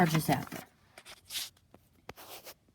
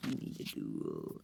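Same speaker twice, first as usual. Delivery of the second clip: much lower, softer, slow alien; deep slow voice of a menacing alien; 8 dB quieter, slower than usual. Acted. Alien speaks.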